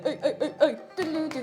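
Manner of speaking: normal talking voice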